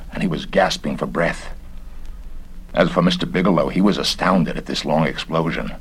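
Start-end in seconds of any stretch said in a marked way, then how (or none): none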